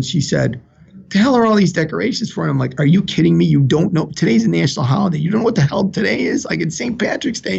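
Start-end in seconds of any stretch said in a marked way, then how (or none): 1.1-2.4 quick, high-pitched puzzled nurse; retold sharp question, pitched up and fast; baffled demand. Nurse asks father about the ICU decorations, baffled. 2.8-7.6 high, incredulous comeback; playfully appalled that anyone could not know; mock indignation. Father tells nurse it's Saint Patrick's Day, mock-indignant.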